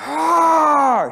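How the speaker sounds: very loud, high mock roar; imitated warrior roar. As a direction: let out a boy's battle cry. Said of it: "He had to roar".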